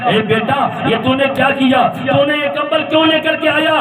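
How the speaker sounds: high, loud storytelling sermon; preacher voicing the harsh son; fiery preaching. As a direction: preach it out with rising fervour. Preaching the story at full force.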